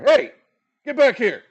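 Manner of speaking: loud, high guard's yell; guard shouting. Acted. Guard shouting after thieves on the rooftops, loud and high. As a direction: bark after the fleeing thief.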